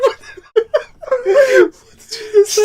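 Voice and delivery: high, laughing through the words; laughing Trump quote. Retold or imitated. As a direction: squeak it out cracking up. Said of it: "he's like".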